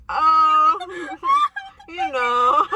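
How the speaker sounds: laughing guiltily through the excuse; nervous laugh. Retold or imitated. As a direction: cover the lie with a shaky laugh. Lying to mom, caught outside.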